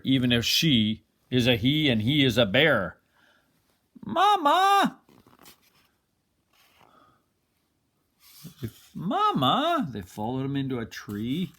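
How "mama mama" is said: put-on very high, loud squeaky baby-goose calls; gosling cries. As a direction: squeal it like a chick. Goslings follow him.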